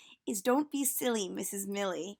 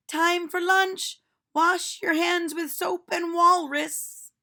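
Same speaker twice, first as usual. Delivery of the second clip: higher, louder, slow teacher greeting the class; a cheerful teacher calling out to children; bright sing-song schoolteacher, slow and loud; silly teacher voice; 8 semitones higher, 9 dB louder, slower than usual. Acted. Teacher speaks.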